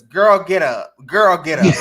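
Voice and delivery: loud, rallying preacher command; Sarah Jakes Roberts. Retold or imitated. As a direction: preach it like a pastor lifting a woman up. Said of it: "In my Sarah Jakes Roberts voice".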